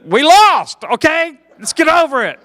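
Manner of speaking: high, loud exasperated plea; mock shout. Comedian yelling at Southerners, loud and exasperated. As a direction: yell it at the diehards. Comic telling Southerners to move on.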